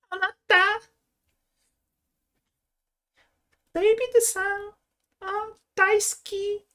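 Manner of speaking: very high, drawn-out cutesy Japanese voice; squeaky cute Japanese voice. Acted. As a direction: put on a sugary anime-style squeak. Performing cute Japanese character voice.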